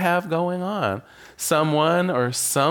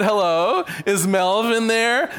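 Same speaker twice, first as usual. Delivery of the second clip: higher, slower drawn-out phone greeting; sing-song caller; 6 semitones higher, slower than usual. Retold or imitated. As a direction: stretch "there" up into a lilting rise. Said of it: "and said".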